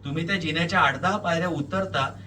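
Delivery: ordinary delivery, nothing marked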